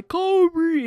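high, loud, drawn-out call; shot-calling shout. Retold or imitated. Imitating everybody shooting into a garbage can, shot-calling shout. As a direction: holler it like everyone shooting at the bin.

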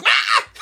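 imitated gremlin squawk; sudden goblin screech; short creature cry; John Adams shriek. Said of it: "John Adams goes over there and he's like".

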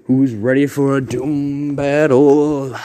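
slow hyped announcer call. Acted. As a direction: drag it out like a ring announcer. Announcing the battle.

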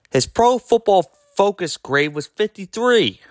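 ordinary talk

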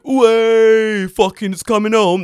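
high, loud, cheering yell; mock football cheer. Friend mocking fans cheering the World Cup, loud and high. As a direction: roar it like a fan at kick-off. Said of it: "everyone was like".

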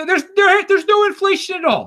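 high mock claim of no inflation. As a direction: sarcastically parrot the official line. Mocking claim, then real tone.

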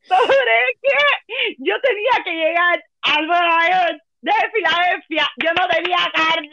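high, teary laughing through the words; half laughing, half crying. Real, not acted. Retelling overwhelmed by emotion.

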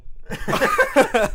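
squealing laugh; high burst of laughter. Real, not acted. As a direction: shriek with laughter. Mic pulled out mid-laugh.

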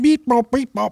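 high mechanical beep-bop noises; robot beeping. Pretend. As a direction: chirp like a toy robot. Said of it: "walking around like a robot".

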